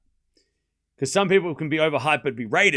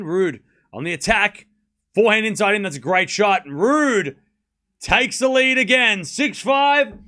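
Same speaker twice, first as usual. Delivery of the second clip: rising, much louder excited tennis call; excited commentator voice, higher and louder; live play-by-play; 6 semitones higher, 6 dB louder than usual. Acted. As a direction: call the rally as it happens, building up.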